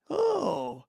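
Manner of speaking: high, soft fake "oh"; mock surprise. Host mimicking the officers faking surprise at the body. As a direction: fake a surprised gasp, pitch leaping up. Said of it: "they act surprised".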